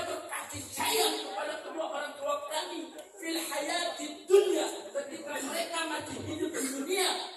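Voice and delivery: high, loud preaching prayer; fervent prayer. Acted. Preacher praying aloud for parents, fervent and high. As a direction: lift the prayer up to God with fervour.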